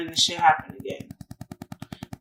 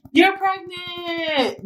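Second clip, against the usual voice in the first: slow announcement; 9 semitones higher, much slower than usual. Retold or imitated. Urgent care announces pregnancy.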